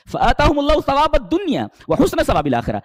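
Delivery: high, loud chanted verse. Acted. Preacher reciting the verse on reward, loud and high. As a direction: ring the verse out.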